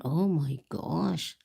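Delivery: ordinary talk, nothing marked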